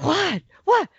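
high-pitched disbelieving "what"; incredulous. Retold or imitated. Father to son who didn't shoot, incredulous. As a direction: blurt it twice, high, in disbelief. Retold disbelief on finding no kill.

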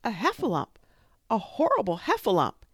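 high, loud, terrified squeal; frightened Piglet. A narrator's reading. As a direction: shriek it out as a tiny scared pig. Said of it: "cried Piglet".

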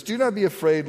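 gentle comforting plea; tender verse reading; tender reassurance. Shown as a demonstration. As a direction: say it like soothing a child. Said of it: "term of endearment".

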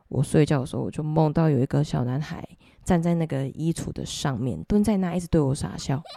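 ordinary talk

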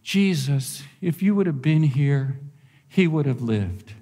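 a sweet, tender telling; sweet and gentle. Retold or imitated. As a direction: make it sound sweet and nice. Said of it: "it's so sweet".

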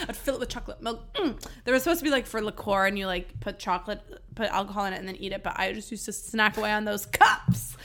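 ordinary delivery

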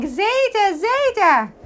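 put-on high childlike voice calling grandpa; a small boy's sweet high call; little grandson calling. Small grandson tugging grandfather's beard, high childlike voice. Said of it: "And he's saying".

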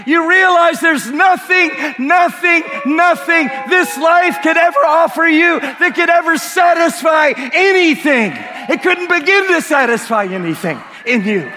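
rising shouted preaching, high; preaching at full force. Acted. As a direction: build to a fervent shout. Preaching climax.

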